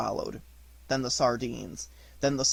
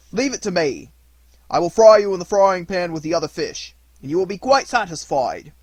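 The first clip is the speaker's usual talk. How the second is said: fisherman's voice; 3 semitones higher, 7 dB louder than usual. Acted.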